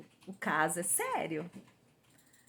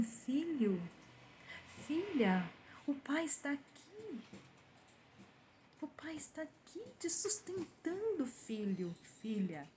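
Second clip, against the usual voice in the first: soft, tender, whispered deceiver's voice; seductive false voice; 5 semitones higher, 9 dB quieter, slower than usual. Retold or imitated. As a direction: lure the faithful with a sweet hush. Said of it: "uma voz tão maravilhosa, que diz assim". (voz enganadora, doce e sussurrada)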